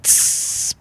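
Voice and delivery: soft unvoiced creeper hiss. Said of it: "how does the creeper sound?".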